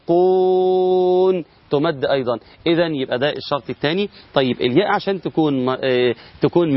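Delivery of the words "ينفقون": ينفقون recited slowly, the long waw held; stretched vowel. Shown as a demonstration. Teacher reciting an example of waw madd, stretched. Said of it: "فأتى بعدها واو ساكنة فتمد".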